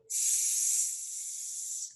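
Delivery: long, steady sssss; snake hiss. Shown as a demonstration. Teacher imitating the snake for children, snake hiss. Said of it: "Es como un siseo".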